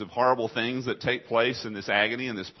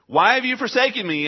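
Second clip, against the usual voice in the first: a loud, high cry of abandonment; anguished cry; 10 semitones higher, 6 dB louder than usual. Acted. Repeated cry of Jesus.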